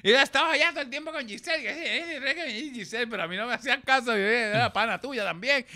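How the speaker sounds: put-on high, fast, complaining girlish voice; high fast mock complaint; imitating Linet. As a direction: whine like a slighted young actress. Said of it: "ella me dice".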